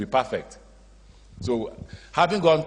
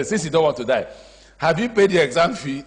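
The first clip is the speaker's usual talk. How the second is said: raised, higher pitched catching-out question; spring the trap question on them, loud; laughing half-mock interrogation; pointed raised question; 7 semitones higher, 7 dB louder than usual. Teacher confronted over fees.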